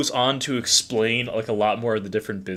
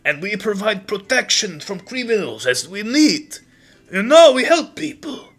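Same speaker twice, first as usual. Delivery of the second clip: put-on high, accented, hearty character voice; enthusiastic Boris; 9 semitones higher, 5 dB louder than usual. Boris welcomes June into the Syndicate, enthusiastically. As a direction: gush proudly in a high accent. Game master resumes Boris's voice.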